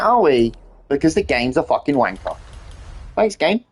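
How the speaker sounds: ordinary talk, nothing marked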